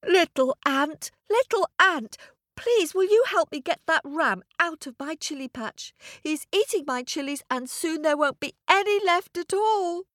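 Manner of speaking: put-on high pleading child voice; pleading boy. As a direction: beg the tiny ant for help. Boy begs the ant.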